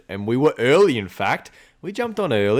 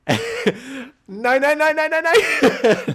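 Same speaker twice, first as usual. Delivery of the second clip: much higher, laughing protest; dismayed laughing no; 3 dB louder, much slower than usual. Real, not acted. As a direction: wail as the mouse gets away. Watching mouse escape.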